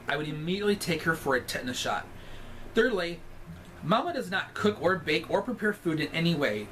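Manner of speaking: ordinary talk, nothing marked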